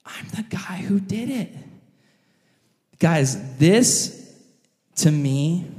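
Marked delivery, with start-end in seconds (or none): 0.1-1.6 stunned whisper. Acted. David the king realizing his own guilt. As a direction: let it sink in quietly. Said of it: "And it hits David".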